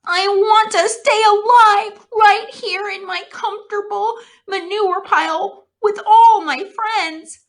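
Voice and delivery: high, loud weepy wail; crying piglet longing to live; tearful pleading. A narrator's reading. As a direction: sob out every wish. Wilbur weeping, pleading to live.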